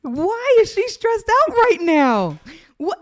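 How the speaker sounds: high, loud playful alarm; mock-alarmed shout; excited. Real, not acted. Reacting to heavy breathing.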